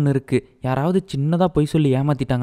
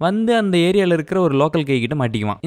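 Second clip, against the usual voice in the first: ordinary delivery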